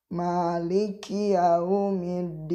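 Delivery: yaumiddin read slowly, each letter given its length; teacher slowly spells out yaumiddin for students, careful and drawn out; slow tajwid reading. Shown as a demonstration. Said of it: "tadi huruf mim dua harokat".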